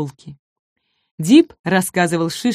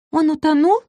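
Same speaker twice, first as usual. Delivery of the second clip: high, small timid voice; little dinosaur's worried squeak; timid question; 9 semitones higher than usual. A narrator's reading. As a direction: ask timidly, pitch right up. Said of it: "робко спросил Шишка".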